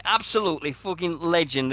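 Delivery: ordinary talk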